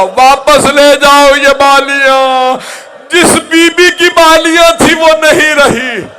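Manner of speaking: high, very loud drawn-out wail; crying out. Acted. Rabab seeing the earrings returned, crying out in grief. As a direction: wail it at full voice, stretching the words. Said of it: "फिर रो के कहा".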